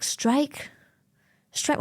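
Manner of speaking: puzzled echo of English strike; English pronunciation. Acted. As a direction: strike with an English s-t start, questioning. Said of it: "don't pronounce it German".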